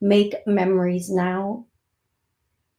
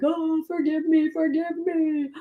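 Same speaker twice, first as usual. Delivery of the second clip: imitating her own sobbing plea, higher; retold sobbing; 8 semitones higher than usual. Retelling her hypnosis to viewers, mock tearful sobbing. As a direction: replay the crying prayer in a mock-tearful voice. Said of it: "like, crying and sobbing".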